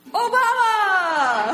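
long drawn-out shout, high and loud; yelled name. Retold or imitated. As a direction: yell it slowly across the street. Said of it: "he opens his mouth to yell at me".

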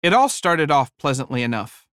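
ordinary delivery

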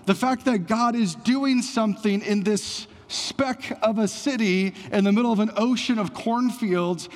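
preaching at full force. Acted. Pastor tells the congregation they are no mistake, preaching. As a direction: drive it home to the church.

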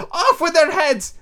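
very high, drawn-out shriek; furious Queen. A narrator's reading. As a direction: pass sentence as a furious queen. Queen orders executions.

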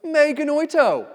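high, loud "Me genoito" as the strongest possible no; emphatic shout. Acted. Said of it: "Paul's like".

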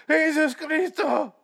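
very high, slow wail; hammed-up cry to heaven; mock anguished cry. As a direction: stretch it out high in pretend agony. Acts out victim crying out.

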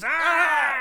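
high mock death scream; imitated scream. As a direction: act out a man screaming in pain. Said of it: "Eddie screams".

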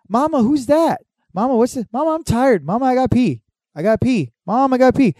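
put-on high whiny little kid voice. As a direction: imitate a needy little kid. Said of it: "everyone's talking".

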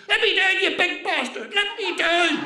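high, loud screech of outrage; shouting and screaming. Acted. Old lady slung over his shoulder, screaming in protest. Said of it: "started shouting and screaming".

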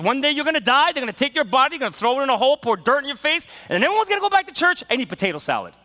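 high, loud, quickening pulpit delivery; preaching. Retold or imitated. Pastor preaching to the students with a loud, quickening delivery. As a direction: build it up fast like a fired-up preacher. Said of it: "He goes".

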